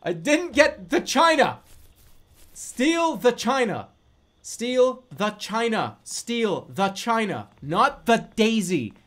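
loud, slow, stressed repetition; real frustrated shout of the goal; exasperated yelling. Real, not acted. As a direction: hammer each word home in frustration. Frustrated after failing mission.

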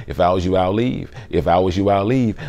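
low, soft murmur of advice; still small voice. Acted. Conscience advising someone among troublesome people, still small voice. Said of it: "hear that still small voice saying".

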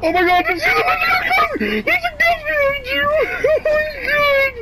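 very high, panicked shriek; shrieking, breaking into nervous laughter; terrified scream. Real, not acted. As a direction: scream a warning in real terror. Screams warning of a ghost.

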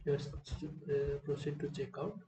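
ordinary delivery

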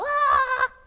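loud high shout of "ah". Shown as a demonstration. Teacher shows children what not to do, loud shout. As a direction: demonstrate a loud, high outburst. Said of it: "shouting out".

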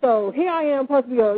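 ordinary delivery

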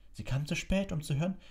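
high-pitched, determined objection; firm. A narrator's reading. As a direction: press the point against the teacher. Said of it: "sagte Hermine entschieden". (entschieden widersprechend)

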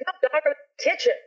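high-pitched mock protest; imitated protesting brother. Brother denying he was on the roof, imitated high protest.

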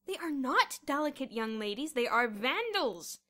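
loud, forceful listing of outrages; angry complaint. A narrator's reading. Headmaster accusing the girls to Miss Scrimmage, angry. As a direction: rail at someone down the phone.

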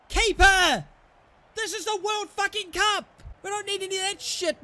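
high, loud yell at the keeper; furious shout. Real, not acted. Gamer raging at his own goalkeeper, furious shout. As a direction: blast the keeper for the blunder.

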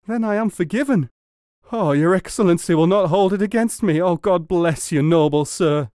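high, loud, fervent thanks; overwhelmed gratitude. A narrator's reading. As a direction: gush with relief. Forgiven muleteer overjoyed and grateful.

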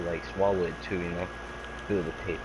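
usual speaking voice

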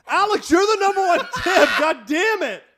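high, loud laughing outburst; cracking up at him. Real, not acted. Laughing at co-host.

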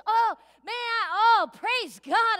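loud, high excited cry of joy; joyful shout. Pretend. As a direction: burst out with joy at the reunion. Greeting loved ones in heaven.

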